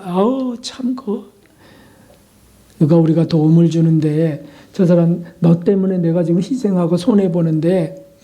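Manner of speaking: ordinary talk, nothing marked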